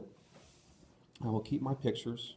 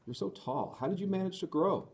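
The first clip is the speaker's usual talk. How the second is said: rising in amazement, much higher; amazed; 9 semitones higher than usual. Acted. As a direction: ask it in delighted wonder. Rosa marvels at his height.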